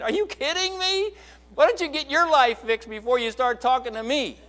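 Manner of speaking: high, incredulous mock colleague; scoffing colleague. Pastor voicing an office colleague mocking a hypocrite. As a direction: scoff like a skeptical coworker.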